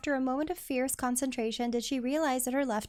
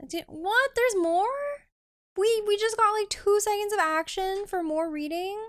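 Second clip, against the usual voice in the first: indignant disbelief; 8 semitones higher, 4 dB louder than usual. Real, not acted. Streamer outraged at more reading.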